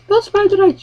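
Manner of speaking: put-on high puzzled kid voice; a small child not getting it; confused child. Child asks mother.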